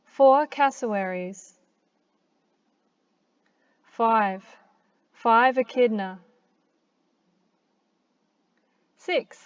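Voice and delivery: clear, careful Australian accent for learners; natural Australian accent, said like a teacher's model. Shown as a demonstration. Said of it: "pronounced with an Australian accent".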